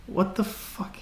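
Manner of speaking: breathy whispered swear under the breath; whispered curse. Real, not acted. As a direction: hiss the curse to yourself, barely voiced. Muttered frustration at screen.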